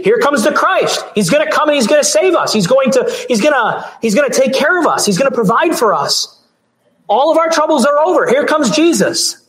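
high-pitched, thrilled welcome of a long-awaited saviour; acting out an overjoyed, hopeful crowd. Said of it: "why they were so thrilled".

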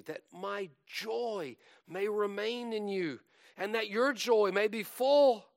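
high declamation swelling to loud; exultant preaching. Acted. Preacher reciting Jesus' wish for full joy, exultant. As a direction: lift it high and ring out the last phrase.

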